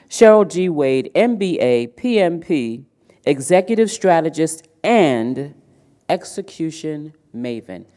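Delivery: proud, punchy title read-out; announcer introduction. Real, not acted. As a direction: announce the keynote speaker's credentials with emphasis. Reads speaker's title as introduction.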